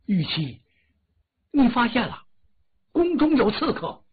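the king's alarm rising up in pitch; nervous king. Acted. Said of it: "纣王闹得好紧张".

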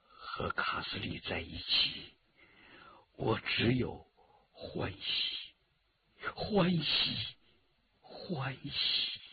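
slow, hushed private thought; inner voice. A narrator's reading. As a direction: murmur it low and softly, as if to yourself. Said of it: "陈家洛心中在说". (心中默念，低声细语)

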